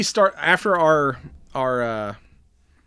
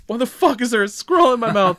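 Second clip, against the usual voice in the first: mock outrage; 10 semitones higher, 5 dB louder than usual. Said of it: "it's like".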